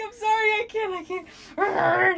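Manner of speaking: high laughing plea; cracking up, can't stop; laughing apology. Retold or imitated. Retelling apologizing to friends while laughing, high. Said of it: "And I was like".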